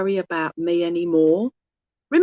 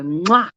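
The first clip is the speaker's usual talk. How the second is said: blown kiss. Acted. Medium passing a spirit's love to client, blown kiss. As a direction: give a quick "mwah" kiss.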